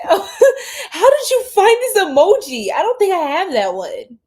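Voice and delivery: high, laughing through the words. Real, not acted. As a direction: read it while cracking up. Reading comment while laughing.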